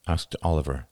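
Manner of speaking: ordinary delivery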